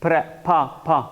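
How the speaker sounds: फा said alone as a short, separate syllable; isolated sound demo. Shown as a demonstration. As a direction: model the sound फा on its own, then repeat it. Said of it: "उसको फा pronounce करता हूँ".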